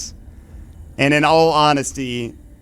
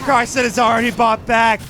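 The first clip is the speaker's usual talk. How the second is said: higher, urgent caster call; excited repeat; 7 semitones higher than usual. Acted. Caster repeats the key news.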